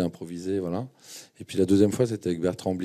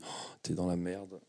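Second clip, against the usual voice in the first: slow, much softer aside to himself; dread to himself; 11 dB quieter, slower than usual. Retold or imitated. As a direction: let the sinking feeling slip out quietly. Inner thought, getting first role. (aparté lent et très bas)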